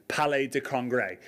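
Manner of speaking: "Palais de Congrès" in a light French accent. Shown as a demonstration. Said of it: "but with a French accent".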